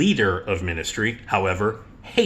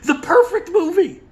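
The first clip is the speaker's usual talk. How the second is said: squealing delight; 5 dB louder than usual. Real, not acted. Savoring the choice.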